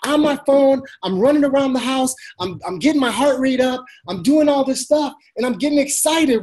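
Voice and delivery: high-pitched, revved-up excitement; excited. Shown as a demonstration. Coach showing how to sound excited on the phone, excited. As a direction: talk like your heart rate is up. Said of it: "you see the excitement in my voice".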